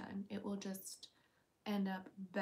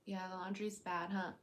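usual speaking voice

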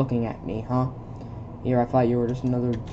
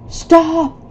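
much higher, loud stop cry; loud shout; 13 semitones higher, 9 dB louder than usual. Acted. Character yelling at Michael to stop, loud shout. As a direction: yell to halt them.

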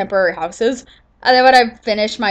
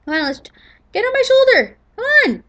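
excited pet call; 12 semitones higher than usual. Real, not acted. Calling parrot to shoulder.

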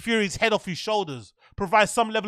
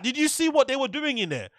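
ordinary talk

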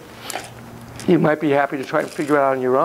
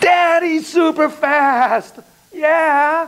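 excited; 10 semitones higher, 3 dB louder, much slower than usual. Acted. Echoing child, slow and high.

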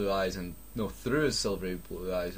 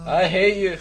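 mock outburst; 10 semitones higher, 11 dB louder than usual. Mock outburst at the chapter title.

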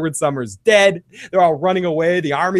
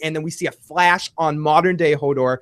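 ordinary talk

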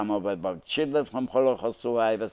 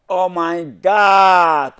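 long, high, loud drawn-out cry of shock; shocked yell; 9 semitones higher, 11 dB louder, much slower than usual. Retold or imitated. Wife opening the trunk and finding drums, long loud shocked yell. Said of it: "Why are you la-, yelling?".

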